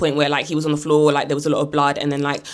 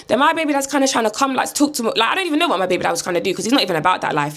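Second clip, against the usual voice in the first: plain reading voice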